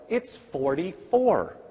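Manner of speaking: coaxing teacher. Retold or imitated. Teacher coaxing a first grader.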